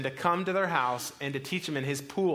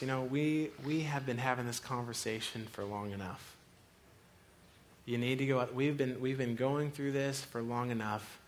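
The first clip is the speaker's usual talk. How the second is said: softer, patient fatherly urging; gentle, firm voice; 3 semitones lower, 5 dB quieter than usual. Retold or imitated. God tells us it's time to swim, soft and firm. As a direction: coax a stubborn child forward quietly.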